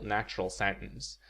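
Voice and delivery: ordinary delivery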